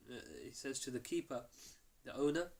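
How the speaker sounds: usual speaking voice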